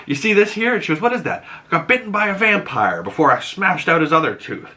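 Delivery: put-on high, eccentric old witch; lightly voiced batty Lilia; mock Lilia voice. Lilia's eerie tale.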